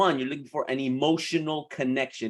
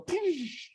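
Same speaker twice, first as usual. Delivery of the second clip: high, soft whooshing poosh; a little drop sound effect, high; 14 semitones higher, 6 dB quieter than usual. Pretend. Coach marks dropping a name with a high soft poosh.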